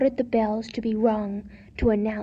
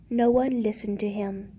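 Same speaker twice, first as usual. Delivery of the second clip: ordinary delivery, nothing marked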